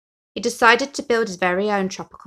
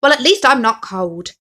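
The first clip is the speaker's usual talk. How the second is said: louder, firm brave-face tone; firm self-reassurance; 4 semitones higher, 10 dB louder than usual. Acted. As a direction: talk yourself into it. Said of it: "Henry told himself firmly".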